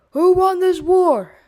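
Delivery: big loud sailor's shout, slowed; yelled question. A narrator's reading. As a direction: bellow it to the crowd. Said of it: "he yelled".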